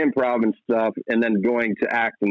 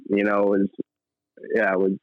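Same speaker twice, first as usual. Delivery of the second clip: ordinary talk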